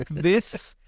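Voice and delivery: high, slow, hammed-up defiance; mock grand proclamation. Hosts joking about banned Aussie phrases, hammed-up defiant declaration. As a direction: declare it like a pompous tyrant-fighter.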